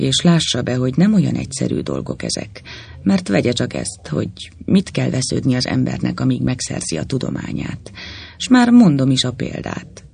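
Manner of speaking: ordinary delivery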